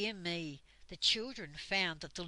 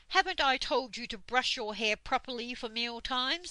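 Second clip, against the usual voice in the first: louder, higher stern telling-off; scolding; 5 semitones higher, 5 dB louder than usual. A narrator's reading. Dame Slap scolding Twinkle about her hair, stern. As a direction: scold the pixie like a cross old schoolmistress.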